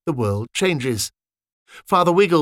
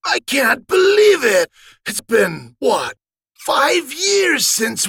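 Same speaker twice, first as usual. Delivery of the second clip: much higher, louder, slow and gushing; overjoyed; 12 semitones higher, 4 dB louder, much slower than usual. Acted. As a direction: babble with happy disbelief. Joyful reunion continues.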